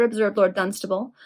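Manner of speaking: usual speaking voice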